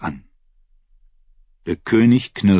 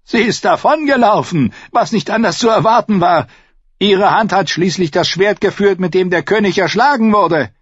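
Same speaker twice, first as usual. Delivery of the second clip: put-on higher, sneering young knight; a higher-voiced scornful knight; mocking knight; 12 semitones higher, 5 dB louder than usual. A narrator's reading. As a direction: scoff at the absent maid. Narrator voices Morrigan.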